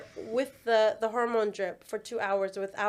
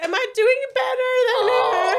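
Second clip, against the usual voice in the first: high, loud mock plea; overexcited retold demand; retold desperate question; 13 semitones higher, 8 dB louder than usual. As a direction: call out to mum, hammed-up. Retold asking mum while high.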